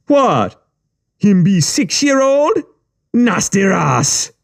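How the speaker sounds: high, slow disgusted Caribbean-tinged voice; mocking thug. A narrator's reading. Thug sneering at the wet boy, high and slow. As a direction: drag out the disgust.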